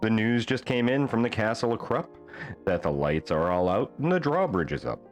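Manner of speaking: plain reading voice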